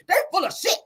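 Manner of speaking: imitated high-pitched rant; mock yell, pitched high at the start and easing down; retold yelling. Nell still yelling as she goes inside, mock high yell. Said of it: "She's still yelling".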